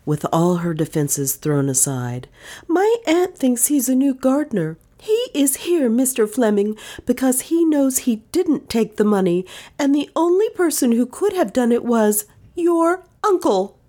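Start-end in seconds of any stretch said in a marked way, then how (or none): 2.7-13.8 put-on high, fierce young woman; indignant young woman. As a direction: defend him fiercely. Dale voiced by narrator.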